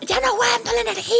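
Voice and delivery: very high wailing imitation; mock crying. Teacher crying over the energy, mock wail. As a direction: wail it like someone melodramatically crying. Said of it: "Y ella lloraba".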